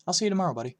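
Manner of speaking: high, loud, excited gossip; eager kid Evan. A narrator's reading. Classmate Evan pestering the new kid, high and loud. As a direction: blurt it out like a nosy classmate.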